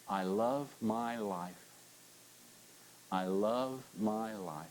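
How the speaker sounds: very slow and soft, meaning every word; preacher modelling the words for the whole congregation; heartfelt. Shown as a demonstration. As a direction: let each word land. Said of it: "say this with enthusiasm and mean it".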